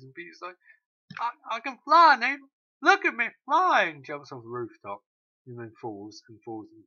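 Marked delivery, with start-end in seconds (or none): 1.2-3.8 high, loud overexcited cry; mock-manic Peter before jumping; excited yell. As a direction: shout it like a deluded daredevil. Peter voiced, about to jump.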